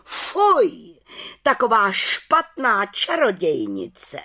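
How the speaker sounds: high, slow, scandalised exclamation; outraged cry. A narrator's reading. Head witch appalled before the council, outraged cry. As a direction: cry out in disgust, stretching the words high. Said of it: "najednou pohoršeně vykřikla".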